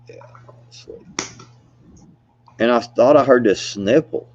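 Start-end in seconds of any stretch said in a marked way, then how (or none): 0.1-1.1 drowsy whisper, nearly asleep; half-asleep murmur; sleepy whisper. Retold or imitated. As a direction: breathe it out like you're dozing off. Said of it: "I'm dozing off".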